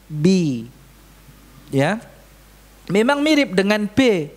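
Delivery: ordinary talk, nothing marked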